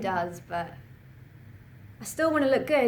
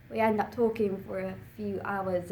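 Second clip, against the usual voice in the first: usual speaking voice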